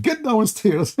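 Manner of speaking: dad yelling. Retold or imitated. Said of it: "He kicked my butt and he said".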